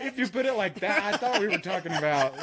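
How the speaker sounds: high, laughing words; giggling while explaining herself. Real, not acted. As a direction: speak through a laugh. Laughing at her own realization.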